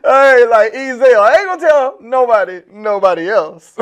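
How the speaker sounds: high, loud talk through laughter; real boisterous laughing delivery; laughing hard. Real, not acted. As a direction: crack up while getting the words out. Cracking up at own joke.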